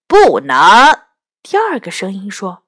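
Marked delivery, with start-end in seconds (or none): 0.1-0.5 loud, low, blunt no; acted stubborn outburst; defiant refusal. A narrator's reading. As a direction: refuse loudly, cutting the plea off. Second head flatly refuses.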